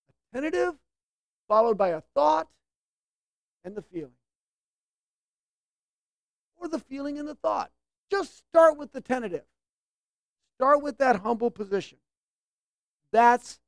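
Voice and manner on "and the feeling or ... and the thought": ordinary delivery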